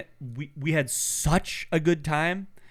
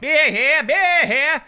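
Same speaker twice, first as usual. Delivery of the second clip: high, loud hawker's call across the stands; loud sing-song vendor cry; beer vendor call; 11 semitones higher, 8 dB louder, much slower than usual. Retold or imitated. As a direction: bellow it like a stadium beer guy. Stadium beer vendors calling out.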